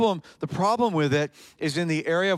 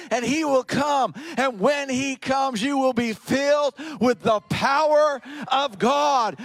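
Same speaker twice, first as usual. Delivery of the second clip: raised, slowed, ringing preacher's climax; preaching at full force; 6 semitones higher than usual. Acted. Preacher pressing the congregation toward the Holy Spirit, fervent. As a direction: build the congregation to a fervent peak.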